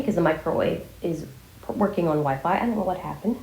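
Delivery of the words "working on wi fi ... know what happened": ordinary delivery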